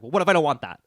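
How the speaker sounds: loud, challenging question; retold indignant objection; retold pushback. Retelling pushback to the clerk.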